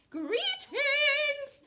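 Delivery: loud, high, sing-out royal greeting; stretched, ringing welcome; crying out. A narrator's reading. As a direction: hail the crowd grandly as a king. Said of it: "cried the king".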